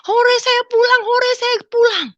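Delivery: put-on very high, loud childlike cheering; bersorak gembira. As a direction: act out jubilant hurrahs, drawing them out. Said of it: "Saya gembira". (sorak gembira bernada tinggi)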